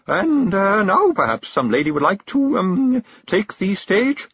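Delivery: high, over-bright hostess about to shatter; brittle brightness. A narrator's reading. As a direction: chirp it hesitantly, drawing out the end. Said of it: "as brightly as a glass ball about to shatter".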